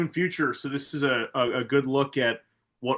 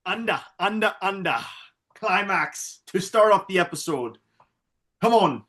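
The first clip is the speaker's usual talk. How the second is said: mock Irish accent; 4 semitones higher than usual. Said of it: "spot on Irish".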